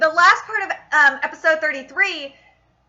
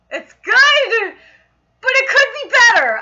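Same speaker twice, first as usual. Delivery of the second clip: higher, grudging approval; qualified approval; 7 semitones higher, 4 dB louder than usual. Retold or imitated. Reviewer replaying a first reaction to the scene, grudging. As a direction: concede it, but sound unconvinced.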